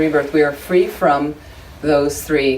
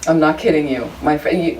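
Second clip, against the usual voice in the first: usual speaking voice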